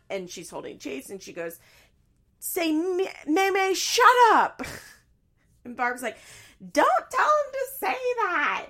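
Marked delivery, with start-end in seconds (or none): 2.4-4.6 very high, loud hammed-up shriek; mock screaming. Daughter holding her child, mock screaming at mom. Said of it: "Janelle's screaming". 6.6-8.7 very high, slow, loud screech; mock screaming. Mom objecting to her daughter, mock screaming. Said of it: "Barb's screaming".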